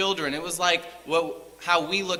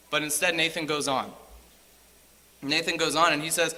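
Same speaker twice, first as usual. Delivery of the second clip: ordinary delivery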